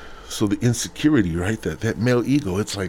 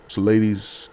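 ordinary talk, nothing marked

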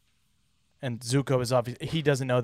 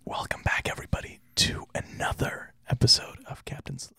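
ASMR whisper; 3 semitones higher than usual. Shown as a demonstration. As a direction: whisper close to the mic. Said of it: "since I'm choosing to do the whisper".

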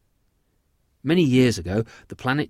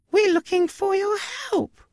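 very high squeaky mouse voice; high-pitched cartoon mouse; tiny alien mouse; 4 dB louder than usual. Mouse answers the farmer.

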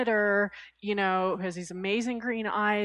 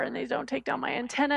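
normal talking voice

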